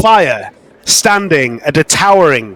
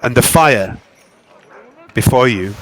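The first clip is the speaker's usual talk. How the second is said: game master narration; 4 semitones lower than usual. A narrator's reading. Game master describing a goddess vision, hushed narration.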